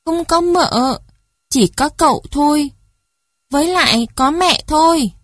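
put-on high, childish voice correcting a grown-up; teasing child. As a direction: answer as a cheeky little child. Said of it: "Ái cười như chế nhạo bà khách". (giọng trẻ con trêu chọc, cao vút)

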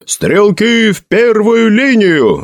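high, long-drawn military call; shouted relay. A narrator's reading. Soldiers echoing the captain's command, shouting. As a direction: pass the order down the line. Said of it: "закричали".

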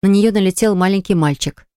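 ordinary delivery

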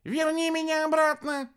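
high, loud shout. A narrator's reading. Хелений demands the old woman restore him, shouting. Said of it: "закричал на кутерьму".

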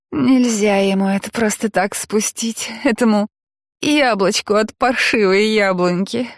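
a hint of tipsy high giggling; lightly voiced drunk girl giggling; giggly drunk. A narrator's reading. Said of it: "пьяно хихикнула она".

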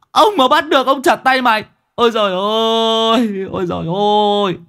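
high, loud lament for the dead fish; an old man howling over his ruined pond; wailing old pond owner. A narrator's reading. The pond owner wails over his dead fish, high and loud. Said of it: "gào lên".

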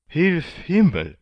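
high, loud exclamation of disbelief; high-pitched, shouted astonishment; astonished cry. A narrator's reading. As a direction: cry out in amazement, stretching the last words. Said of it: "schrie der Kommandant".

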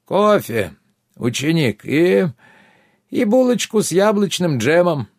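distant voice through a speaking tube; far-off voice, as if heard down a pipe. A narrator's reading. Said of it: "услышал далёкий голос Родиона".

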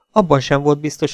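usual speaking voice

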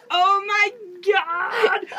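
high, loud, drawn-out slow cry; ecstatic cry. Retold or imitated. Teammate erupting in delight at the answer. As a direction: scream it in delight. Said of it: "Och han bara".

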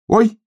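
high, loud "oy"; startled yelp. A narrator's reading. Hedgehog stumbles blindly into something, startled yelp. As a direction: yelp it high on a sudden bump. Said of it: "вскрикнул он".

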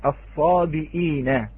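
الصابئين said slowly with the hamza, asabiina; slow clear reading. Shown as a demonstration. Teacher sounding out الصابئين for students. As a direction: spell out الصابئين with the glottal stop, drawn out. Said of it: "الكلمة الأولى كلمة".

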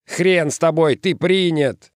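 high holler over a brawl; yelling. A narrator's reading. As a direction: yell out a grudging acceptance. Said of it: "проорал ему человек".

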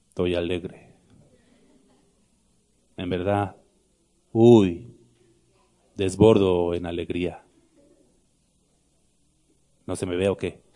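low, glum mock claim of joy; deadpan, draggy fake happiness; joyless sarcasm. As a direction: claim to be overjoyed in a dead, low voice.

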